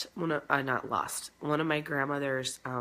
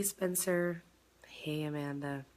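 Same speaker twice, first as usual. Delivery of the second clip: ordinary talk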